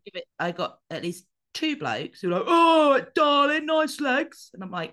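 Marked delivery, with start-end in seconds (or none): 2.5-4.5 put-on high, loud leering shout of a drunk bloke; a loud, high, mocking catcall from a passing bloke. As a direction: ham up a lecherous catcaller yelling from the street.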